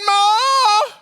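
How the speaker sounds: high, loud drawn-out yell for Ma; screamed call for mom. Retold or imitated. Hurt little kid calling mom to the backyard, screaming. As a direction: holler at the top of your lungs. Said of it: "I was screaming".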